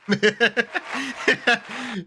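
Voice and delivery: high burst of laughter; real laugh. Real, not acted. Reacting to the narrator saying Horus heresy, real high laugh. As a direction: crack up, high and helpless.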